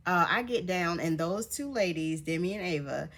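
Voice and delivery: usual speaking voice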